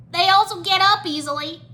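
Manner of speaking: high, loud, alarmed; nervous gulp. A narrator's reading. Jay sees skeletons reassemble, nervous alarm. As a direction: blurt it out in rising alarm. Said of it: "Jay gulped".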